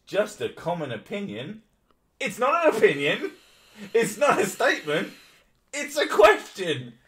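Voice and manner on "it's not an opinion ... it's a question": high, loud exasperated building rant; escalating outrage, slowing on the last. Real, not acted. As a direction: hammer each correction harder. Exasperated ranting at article.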